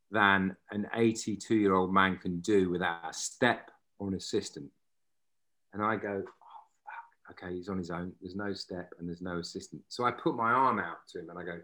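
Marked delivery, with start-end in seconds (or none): 6.2-9.8 hushed inner panic. Retold or imitated. Inner panic thought, retold.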